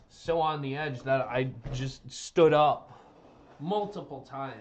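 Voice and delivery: blurting out how hyped he got; excited loud burst. Real, not acted. Streamer recalling the match, excitedly loud.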